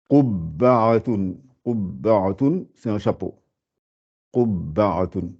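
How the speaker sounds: qubba'atun spelled out slowly; slowly, every sound of qubba'atun; slow careful reading. Shown as a demonstration. Teacher reads qubba'atun slowly to the class. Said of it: "Rappelez-vous comment ça s'écrit".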